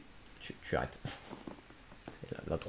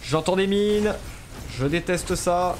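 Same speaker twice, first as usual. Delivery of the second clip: alarmed outburst; 6 semitones higher, 4 dB louder, slower than usual. Real, not acted. Mines heard in game.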